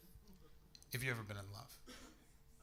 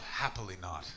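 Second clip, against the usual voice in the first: mock gloomy reply; 9 semitones lower than usual. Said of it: "I've never heard anyone re".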